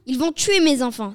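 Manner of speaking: loud, panicked exclamation; alarmed cry. A narrator's reading. Dame Renard fearing for her children, crying out. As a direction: cry out in fear for your children. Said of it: "s'écria Dame Renard".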